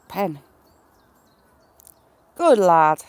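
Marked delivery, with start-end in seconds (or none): 2.3-3.0 praise. Real, not acted. Praising cockerel.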